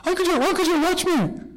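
put-on very high, eager toddler voice; the tiniest child pleading for attention; littlest kid. Littlest one showing off for uncle, excited. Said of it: "and the littlest one".

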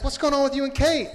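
high-pitched nosy-friend act; hammed-up excited question; eager curiosity. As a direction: play a friend keen for news.

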